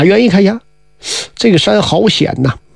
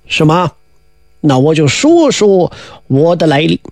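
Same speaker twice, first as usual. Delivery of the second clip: put-on solemn old Taoist; 6 semitones lower than usual. Zhunti Taoist addressing Tianzun, low and solemn.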